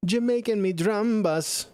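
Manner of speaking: high, slow Jamaican lilt; mock Jamaican accent. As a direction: stretch it out in a put-on Jamaican accent. Joking Jamaican pun after patois talk.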